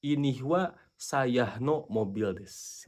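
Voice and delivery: slow, deliberate dictation; slow model sentence. Shown as a demonstration. Teacher showing the reversed sentence slowly. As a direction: say it slowly, word by word. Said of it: "itu harus dibalik".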